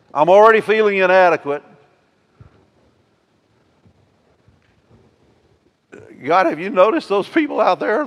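loud, high, worried pleading; a frightened young man listing his fears; preacher voicing Jeremiah's fear of the hostile people; anxious Jeremiah. Jeremiah's inner fears voiced.

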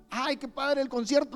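high, light mocking tone; mock carefree sinner. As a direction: parody the careless believer. (pecador despreocupado, imitado en tono agudo)

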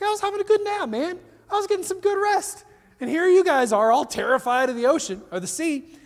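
high, mildly put-out grumble; a touch of irritation at being woken; annoyed Jesus. Retold or imitated. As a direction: play a lightly annoyed sleepy Jesus. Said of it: "Jesus is almost annoyed with them".

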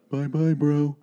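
a lightly voiced movie-villain goodbye; a hint of a cocky villain waving off; Pollux Troy pinky goodbye. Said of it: "Like Pollux Troy does".

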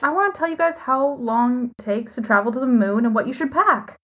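mock-earnest, pitch climbing to a high finish; sarcastic. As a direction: say it with a wink, dead sarcastic. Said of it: "That was sarcasm".

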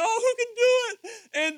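high, slow, sobbing wail; John weeps in heaven, slow, high, sobbing plea; crying John. Retold or imitated. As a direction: cry it out like John in despair. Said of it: "he starts crying".

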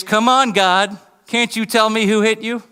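high, jeering taunt, short of a scream; mock scream. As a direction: act out yelling in someone's face. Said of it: "But to scream in his face".

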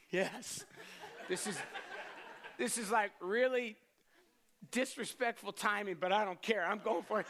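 bursting with giddy joy at his chance; gleeful excitement. Retold or imitated. Young man hears his crush is single, excited laughing.

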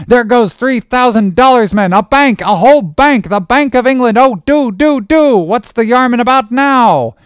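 very high, loud, frantic pleading; Flask's excited cry. A narrator's reading. Third mate dancing, begging his lads to pull, excited cry. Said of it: "cried Flask, dancing up and down".

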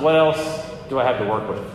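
a hint of a patient God shrugging back. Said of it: "And I think God responds".

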